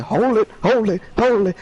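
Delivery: high, slow taunting hold it; mocking boss voice. Gamer mimicking the dancing boss taunting him, high and slow. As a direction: sing it out like a dancing clown.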